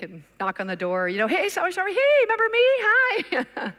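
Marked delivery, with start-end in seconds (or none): 1.3-3.7 high, chirpy hello, a touch over-eager; bright eager greeting. Retold or imitated. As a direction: greet her brightly, pitched way up. Cheerful greeting at the door.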